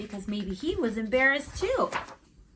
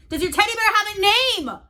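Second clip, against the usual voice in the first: raised, higher call across the room; louder; 12 semitones higher, 9 dB louder than usual. Acted. Boy repeating his question louder to a sleepy friend. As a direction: say it louder so he hears. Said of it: "I said louder".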